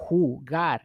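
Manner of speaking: jugar said "hoo-GAR"; Spanish J as H. Shown as a demonstration. Teacher giving another Spanish example, jugar as "hoo-GAR". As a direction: break jugar into airy "hoo" and stressed "GAR". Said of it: "Notice the sound".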